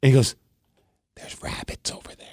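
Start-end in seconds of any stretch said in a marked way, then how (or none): 1.2-2.3 secretive hushed whisper, no voice at all. Retold or imitated. Odd man at the park whispering about rabbits, hushed whisper. Stranger hissing tip by bathroom.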